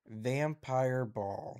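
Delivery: "Vampire ball" drawn out very slowly; "Vampire ball" slow, pondering each word; puzzling over phrasing. Shown as a demonstration. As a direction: stretch out "Vampire ball", testing the odd phrase. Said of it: "It's an interesting phrasing".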